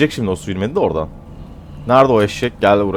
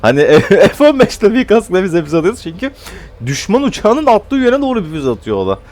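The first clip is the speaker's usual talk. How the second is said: ordinary talk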